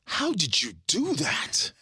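high, amazed disbelief. Retold or imitated. Amazed at his friend's record find. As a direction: gasp it out in wonder. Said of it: "I'm like".

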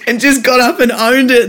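ordinary talk, nothing marked

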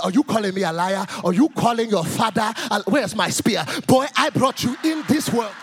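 put-on outraged African dad accent; indignant African-accented parental scolding; African father accent. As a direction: play a stern African father, accent and all. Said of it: "if your father's African".

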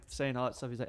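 usual speaking voice

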